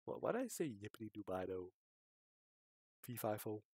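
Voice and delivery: ordinary talk, nothing marked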